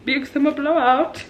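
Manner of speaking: high, loud singsong shopkeeper call; Oaken from Frozen. Retold or imitated. Fan quoting the Frozen shopkeeper, loud high singsong impression. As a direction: belt out a cheery Nordic trader's greeting. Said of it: "the Oakens trading post from Frozen".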